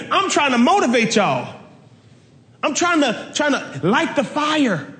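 light the fire, voice lifted high; fired-up preaching. Acted. Paul pleading, quoted believer.

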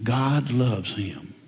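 very slow, soft and weighty; slow and softly sincere; slow emphasis. Real, not acted. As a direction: let each word land gently. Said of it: "as this guy was".

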